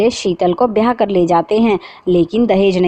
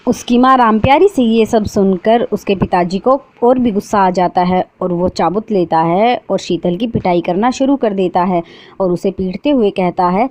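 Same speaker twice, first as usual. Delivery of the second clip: ordinary delivery, nothing marked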